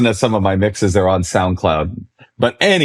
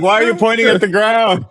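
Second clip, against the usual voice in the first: high-pitched teasing mimicry; mock questioner; 11 semitones higher than usual. Young ravers to an aging raver, mocking high-pitched question. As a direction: imitate baffled onlookers in a much higher voice. Imitating people reacting to his age.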